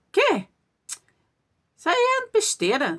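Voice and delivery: lightly acted, high-pitched scoff; mock dismissive. Husband waving away his wife's warning, mildly dismissive. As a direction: imitate someone brushing off a warning.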